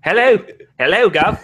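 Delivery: high, loud hammed-up cockney greeting with a laugh; laughing through a bad British accent; mock cockney accent. American guest hams up cockney for his English friends. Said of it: "Schizo do an English one?".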